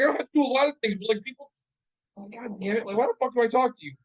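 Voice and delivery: high, loud exasperated retort; worked-up, frustrated protest; exasperated comeback. Retold or imitated. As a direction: retell a fed-up comeback in an argument. Retold argument with his wife.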